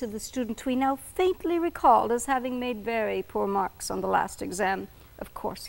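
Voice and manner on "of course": whispered inner thought. A narrator's reading. Narrator voicing the professor's thought, whispered. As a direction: whisper it as his private thought.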